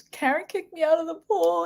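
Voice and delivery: imitated tearful complaint; crying brother. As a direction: whine it like a tattling kid. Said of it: "he's crying".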